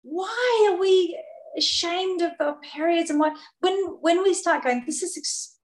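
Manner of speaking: moved almost to tears, pitch lifted; emotional, near tears. Real, not acted. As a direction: speak through the lump in your throat. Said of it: "'cause I'm a bit emotional right now".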